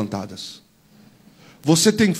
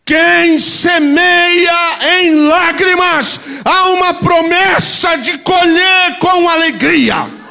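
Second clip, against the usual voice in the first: slow shouted proclamation; 13 semitones higher, 7 dB louder, much slower than usual. Real, not acted. Climactic slow shouted promise.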